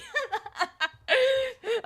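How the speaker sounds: real high laugh. Real, not acted. Cracking up at own remark, long high laughter.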